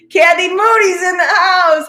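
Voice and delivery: loud, very high shout-out; high, jubilant announcement; excited holler. Real, not acted. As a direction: whoop a welcome across the room. Hollers welcome to arriving participant.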